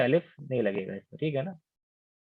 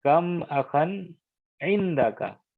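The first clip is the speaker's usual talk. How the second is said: slow alternative phrasing; 3 semitones higher, much slower than usual. Shown as a demonstration. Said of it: "यहाँ पे ये भी हो सकता था क्या".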